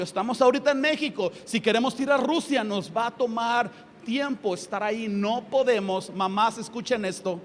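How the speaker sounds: ordinary talk, nothing marked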